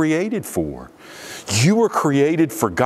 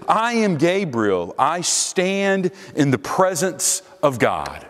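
"I am Gabriel" raised in pitch, with weight on "am" and "stand"; emphatic; 5 semitones higher than usual. Acted. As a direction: proclaim it, leaning on "am" and "stand". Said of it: "it too is in the emphatic".

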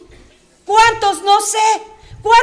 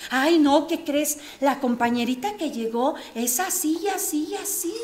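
softer, conspiratorial gossip tone; gossipy hush; 3 semitones lower, 7 dB quieter than usual. Acted. A gossiping churchgoer telling tales about the new girl, hushed. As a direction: lean in and dish the gossip quietly.

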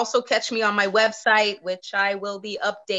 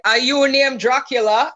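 mock Dracula question, higher and louder; dad's retort; 5 semitones higher, 5 dB louder than usual. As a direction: play the dad's deadpan jab, hammed-up. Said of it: "He's like".

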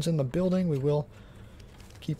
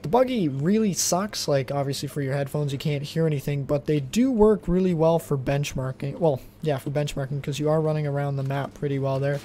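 ordinary talk

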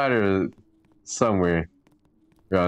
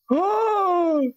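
high gasp. Acted. Character gasps at the spider.

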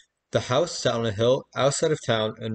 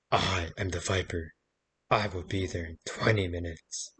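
creepy voice; 4 semitones lower, 6 dB quieter than usual. A narrator's reading. Storyteller voicing a creepy phone caller, mildly menacing. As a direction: menace him gently down the phone. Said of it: "the creepy voice said".

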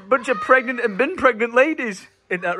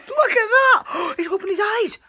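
very high, drawn-out excited cry; thrilled surprise; 13 semitones higher than usual. Real, not acted. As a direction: exclaim in real wonder with a gasp. Baby opens his eyes.

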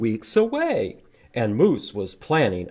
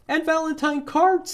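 much higher, squeaky little bunny; cheerful high bunny chirp; 4 dB louder than usual. Acted. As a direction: pipe up like a tiny bunny. Bunny voiced in picture book.

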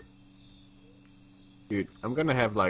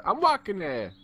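higher, hammed-up New York accent; 5 semitones higher than usual. Shown as a demonstration. Player showing friends how to do the accent, mock New Yorker. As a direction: toss in a cartoon New Yorker line. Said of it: "to do the accent".

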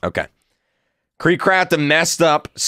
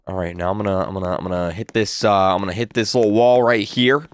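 ordinary delivery

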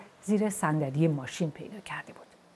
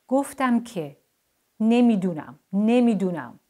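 loud, raised-pitch repeated retort; 4 semitones higher, 8 dB louder, slower than usual. A narrator's reading. Parviz answers the toys' nagging, loud and exasperated. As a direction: snap it back loudly, higher. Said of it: "پرویز با صدای بلند گفت".

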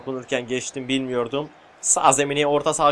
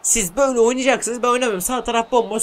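high, loud indignant moan; exasperated whine; whiny complaint; 8 semitones higher, 3 dB louder than usual. Real, not acted. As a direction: protest like a sulking player. Complaining about teammates.

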